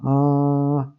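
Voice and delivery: "Ah" as one long, held nasal vowel; nasal vowel sound. Shown as a demonstration. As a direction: hum "Ah" through the nose as a sustained ã. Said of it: "esse som nasal".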